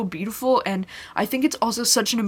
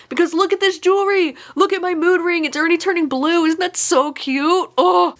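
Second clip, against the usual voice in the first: excited gushing; 10 semitones higher, 6 dB louder than usual. Real, not acted. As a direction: show off the mood ring like a delighted fan.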